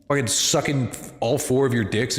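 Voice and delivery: normal talking voice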